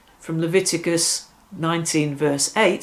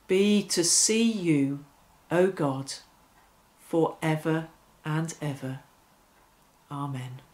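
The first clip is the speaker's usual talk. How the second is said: prayer; 3 dB quieter than usual. Acted. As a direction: pray it gently, line by line. Leading an evening prayer.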